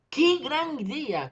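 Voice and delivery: high, slow, delighted cry of ¡Qué gran idea!; joyful exclamation. Shown as a demonstration. Teacher modelling emotion for the class, high and delighted. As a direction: sell the joy of a great idea. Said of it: "Con emoción, con alegría".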